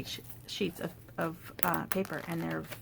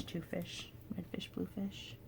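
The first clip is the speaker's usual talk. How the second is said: breathy murmur of the rhyme, much softer; whispered rhyme; 10 dB quieter than usual. Retold or imitated. Crafter quoting the old rhyme to herself, whispered. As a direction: hush the rhyme to herself like a private joke.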